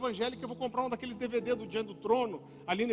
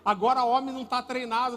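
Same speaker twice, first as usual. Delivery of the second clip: normal talking voice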